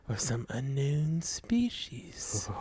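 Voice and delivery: slow breathy whisper. Acted. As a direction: whisper it ominously. Dramatic whispered narration.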